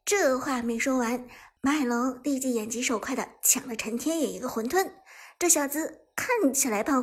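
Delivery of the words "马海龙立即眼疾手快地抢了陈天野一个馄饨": ordinary delivery, nothing marked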